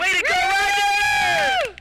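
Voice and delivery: very high, drawn-out whoop; cheering shout. Real, not acted. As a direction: cheer him on with a long squeal. Cheering the caller off.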